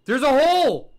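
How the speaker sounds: high, loud, falling shout; thrilled exclamation on a long HOLE; excited discovery. Real, not acted. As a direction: yell out a thrilling find.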